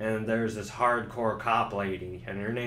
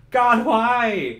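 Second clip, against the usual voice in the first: much higher, louder wail; despairing shout; 10 semitones higher, 10 dB louder than usual. Acted. The player tormented by puzzles, shouting. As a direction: cry out in exasperation. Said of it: "the player's like".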